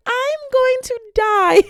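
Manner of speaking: high, loud, slow melodramatic wail; dramatic exhaustion. Retold or imitated. Climbing endless steps, hammed-up high dying wail. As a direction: moan it like you're dying on the stairs.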